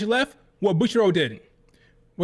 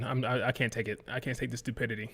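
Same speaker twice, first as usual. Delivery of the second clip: worn-out quiet; 5 semitones lower, 9 dB quieter than usual. Real, not acted. Exasperated, giving up on the rant.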